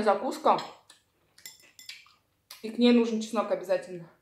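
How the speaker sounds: talking between bites; chewing while talking. Real, not acted. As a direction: mouth full of food. Seen 1: chews beet, then bites garlic clove at 4.7, chews on.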